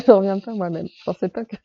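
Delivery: laughing at herself; tickled by her own surprise; amused laughter. Real, not acted. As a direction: talk through a bubbling laugh.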